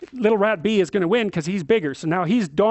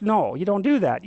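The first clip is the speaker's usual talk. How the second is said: usual speaking voice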